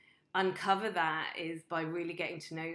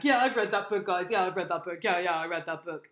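loud, far-off-usual bragging voice, imitated; mock boasting; 9 dB louder than usual. Mimicking people who just read a book and brag, loud mock boasting. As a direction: imitate a loud know-it-all, rattling off repeats.

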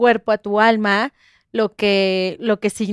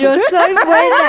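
higher, slowed, laughing "I'm good"; laughing insistence; 5 semitones higher, 4 dB louder, slower than usual. Real, not acted. Protesting her goodness to the hosts, laughing.

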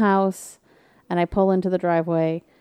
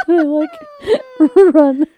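high, loud laughing while telling; 10 semitones higher, 8 dB louder than usual. Real, not acted. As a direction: laugh through the words.

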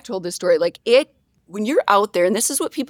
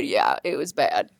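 ordinary talk, nothing marked

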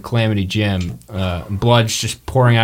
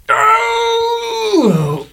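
very high drawn-out scream of no; anguished scream. Acted. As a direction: scream it as the bolt hits. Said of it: "he's like".

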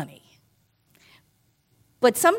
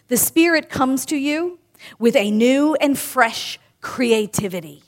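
normal talking voice

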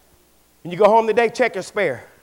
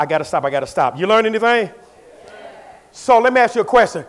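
ordinary talk

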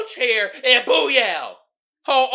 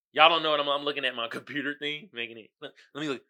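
normal talking voice

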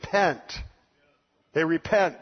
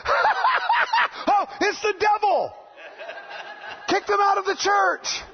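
outraged church member; slower than usual. Retold or imitated. Disapproving member shouting to throw laughers out, mock outrage. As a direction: shriek it like a scandalised churchgoer. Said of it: "somebody over on this side's like".

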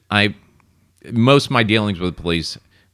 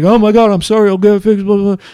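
high, loud mock panic; hammed-up panicky teenage apology, pitched way up; freaking out, imitated; 11 semitones higher, 6 dB louder than usual. Teen driver apologizing to cops, mock freak-out. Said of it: "being seventeen and freaking out".

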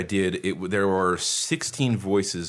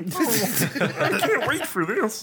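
high goofy voice; 13 semitones higher, much slower than usual. Pretend. High put-on voice.

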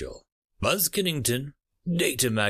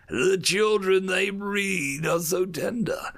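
slow, higher, hungry relish; gloating predator; 8 semitones higher, slower than usual. Acted. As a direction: drool over the memory of the meat. Envoy savouring memory of feasts.

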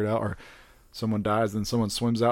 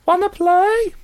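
very high, loud toy bear voice; squeaky creepy teddy bear; soft gentle teddy bear; 9 dB louder, slower than usual. Pretend. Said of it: "I wanna do teddy bear voice now".

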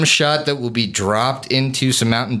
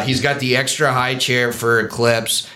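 normal talking voice

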